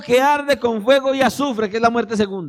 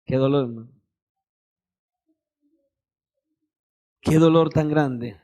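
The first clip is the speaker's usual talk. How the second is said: lamenting; 7 semitones lower than usual. Real, not acted. Preacher lamenting sin to the congregation, low and sorrowful. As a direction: sigh it out sorrowfully.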